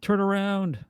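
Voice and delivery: high-pitched mock yell at no real volume. Businessman to the departing train, half-hearted mock yell. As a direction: imitate yelling after a train, held back. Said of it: "he's just yelled".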